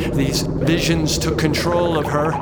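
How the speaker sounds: high, loud, racing rant; frantic rant. A narrator's reading. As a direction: let it spiral out of control. Narration spiralling into rant.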